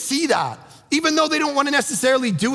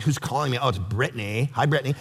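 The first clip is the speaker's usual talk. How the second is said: lightly played Valley Girl on the phone, low-pitched; a low, offhand Valley Girl taking a call; 10 semitones lower, 4 dB quieter, faster than usual. Shown as a demonstration. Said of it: "do that Valley Girl thing".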